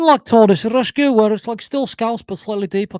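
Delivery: high, loud Scouse impression; Scouse accent. Shown as a demonstration. Demonstrating an earlier James voice, loud Scouse accent. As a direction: attempt a pushed Scouse accent, easing off. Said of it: "Can't do a Scouse accent".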